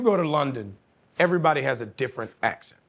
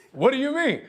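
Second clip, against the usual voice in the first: baffled; 9 semitones higher than usual. Retold or imitated. Director's baffled reply retold.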